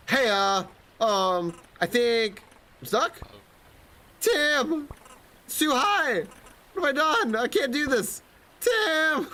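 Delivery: very high, panicky character voice; hammed-up high-pitched panic; panicking climber. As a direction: cry out like someone stuck too high.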